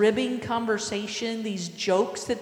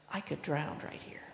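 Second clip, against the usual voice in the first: hushed, much lower aside; whispered realization; 6 semitones lower, 13 dB quieter than usual. Acted. Peter realising on the water, acted fearful whisper. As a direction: whisper it as if danger just dawned.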